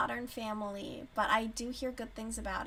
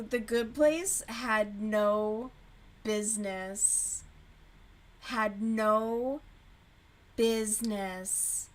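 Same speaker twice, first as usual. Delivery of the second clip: slow, drawn-out protest; emphatic, drawn out; 3 dB louder, much slower than usual. Real, not acted. To chat about the show making her cry, slow and drawn out. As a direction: stretch every word, mock outrage. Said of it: "making me cry".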